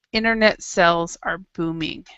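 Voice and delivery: ordinary delivery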